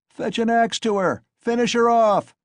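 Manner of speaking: very high, loud brutal cry; shouted. A narrator's reading. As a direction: urge the kill savagely. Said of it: "shouted a third".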